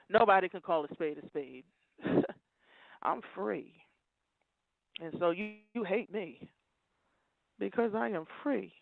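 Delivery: low, soft, halting words; subdued declaration. Real, not acted. Telling opponents why they hate her freedom, low and subdued. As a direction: say it low and quiet, leaving long pauses.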